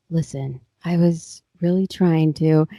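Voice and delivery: imitating a low, earnest pleading voice; mock-sincere low voice; low register. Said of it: "low, um, register, like, voice with Artie".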